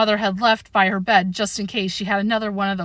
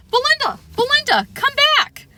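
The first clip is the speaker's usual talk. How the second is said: put-on high, loud girlish call after the dog; 3 dB louder than usual. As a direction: shout after her poodle, much higher. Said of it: "Tiffany Sue called out".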